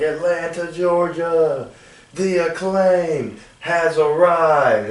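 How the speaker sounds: high, drawn-out arena call; wrestler's entrance announcement. Retold or imitated. Wrestler hyping the host city at the entrance, imitated announcement. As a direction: stretch it out like a wrestling intro. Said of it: "they'll come out and they'll say".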